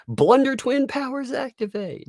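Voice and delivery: high, hammed-up cartoon hero call; mock superhero. Vlogger mocking two rivals as cartoon heroes, hammed-up. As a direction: announce it like a cartoon duo.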